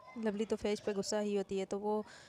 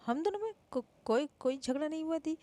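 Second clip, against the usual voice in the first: higher, hurried denial; flustered denial; 6 semitones higher, 5 dB louder than usual. Acted. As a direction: deny it too eagerly, higher. Both deny fighting too fast.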